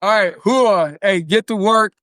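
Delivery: loud gung-ho soldier call; army gusto. Pretend. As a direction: bark it like a drill sergeant. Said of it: "with some army gusto".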